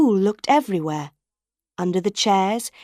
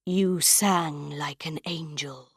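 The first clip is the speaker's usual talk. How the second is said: lower, softer man's voice, slow; a low, hushed, admiring ghost; lightly voiced phantom, soft and slow; phantom's voice; 4 semitones lower, 6 dB quieter, slower than usual. A narrator's reading. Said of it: "the man's voice said".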